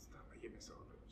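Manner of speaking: breathless, hushed plea; a whiny whisper from a birthday person who wants none of it; whispered whine. Pretend. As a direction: whisper it like a reluctant celebrant. Mocking a reluctant birthday person.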